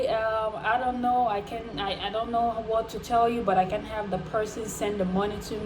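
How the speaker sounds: soft, hesitant mock apology; understated, sheepish voice; retold evasive apology. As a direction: imitate a scammer mumbling excuses. Retold scammer's evasive apology reply.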